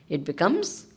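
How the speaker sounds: usual speaking voice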